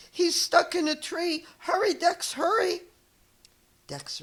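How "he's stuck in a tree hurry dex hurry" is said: high, loud frantic urging; panicked shouting. Acted. Crowd of animals begging Dex to hurry, shouting. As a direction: shout for help, pushing him to hurry.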